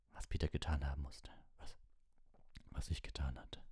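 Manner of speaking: hushed, breaking whisper of guilt; voice failing. Acted. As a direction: let the voice give out. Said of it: "Die Stimme versagte ihm".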